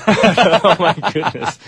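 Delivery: laughing through the words, high and slow. Real, not acted. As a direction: laugh it out in disbelief. Laughing at the act.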